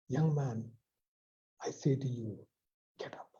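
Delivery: softly spoken, fading to near silence; hushed command. Acted. Preacher voicing Jesus to the dead boy, hushed command. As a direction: say it low, as Jesus at the coffin.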